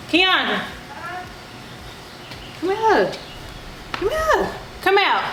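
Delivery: high-pitched summons; high, drawn-in call to come here; calling someone over. Real, not acted.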